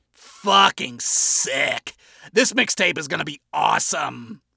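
slow, punched-out celebration; stoked rocker. A narrator's reading. Adam celebrating his finished mixtape, stoked. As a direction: hype yourself up.

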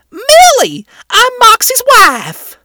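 put-on high, loud imp wife with a Wrath accent. A narrator's reading. Imp wife introducing herself, loud Wrath accent. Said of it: "clearly a Wrath accent".

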